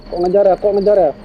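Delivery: alarmed. Real, not acted. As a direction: react as the chase begins. Being chased by game ghost. (panik karena dikejar)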